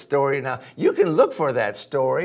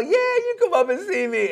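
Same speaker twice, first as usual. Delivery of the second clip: high, fast, eager sweet-talk; excited little dog luring the gator; coaxing dog voice; 3 dB louder than usual. Acted. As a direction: rush to reassure him, way up high. Dog calls while running off.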